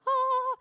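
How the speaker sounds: high, loud delighted squeal; excited squeal. Real, not acted. Traveler thrilled about her coveted A boarding spot, squealing. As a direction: squeal with glee.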